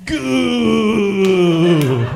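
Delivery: loud hammed-up climax shriek; mock orgasm cry.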